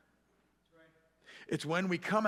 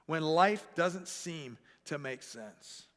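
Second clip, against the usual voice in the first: slow repeat; slower than usual. Shown as a demonstration. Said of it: "The struggle because this is when".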